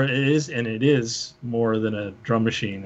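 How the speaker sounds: usual speaking voice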